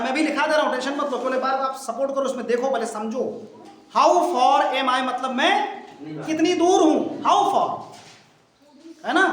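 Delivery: ordinary delivery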